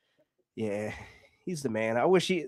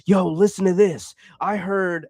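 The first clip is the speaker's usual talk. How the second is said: mock-excited podcast guy, loud burst; excited imitation; 3 semitones higher, 7 dB louder than usual. Podcaster sharing a conspiracy theory, imitated excitedly. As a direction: pitch a hot tip with hammed-up excitement. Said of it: "like, excited about it".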